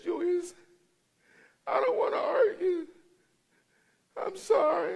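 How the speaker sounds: high, strained tearful pleading; very high, choked-up apology; crying wife. Retold or imitated. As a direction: imitate a wife pleading through tears. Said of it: "and she's crying".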